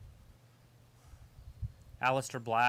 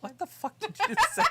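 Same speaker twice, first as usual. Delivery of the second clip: very high, loud, exasperated question; confused outburst; 5 dB louder than usual. Retold or imitated. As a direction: squeal it out in disbelief. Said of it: "so you're like".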